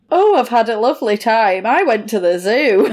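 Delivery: ordinary delivery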